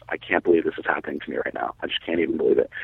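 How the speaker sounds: low, soft retold disbelief; stunned inner thought. Man stuck pulling at the bag thinking to himself, hushed disbelief. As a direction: drop to a soft, low self-talk.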